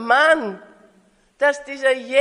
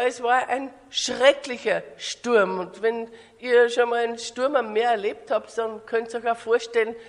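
ordinary delivery

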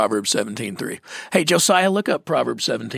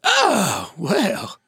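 a touch of mock disgust, higher Oh; 6 semitones higher than usual. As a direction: act disgusted like the old mentor, lightly. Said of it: "he'd act all disgusted".

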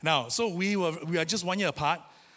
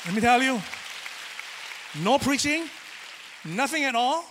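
emphatic preaching; 5 semitones higher than usual. Acted.

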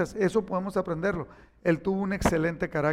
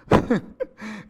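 short laugh. Real, not acted. Laughs about his limp.

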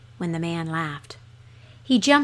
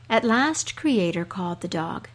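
usual speaking voice

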